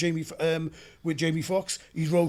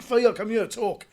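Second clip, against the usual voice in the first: mock Welsh accent; 5 semitones higher, 6 dB louder, slower than usual. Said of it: "Listen to him speak".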